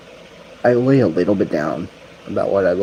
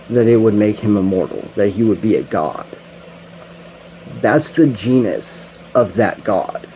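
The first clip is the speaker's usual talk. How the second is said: preaching; 3 semitones higher, 3 dB louder than usual. Real, not acted. Slow emphatic preaching.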